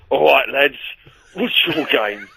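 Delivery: lightly mimicked EastEnders London accent; a hint of EastEnders Cockney hard-man. Joking retelling of men stopping him, light EastEnders accent. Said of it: "They were the Mitchell brothers".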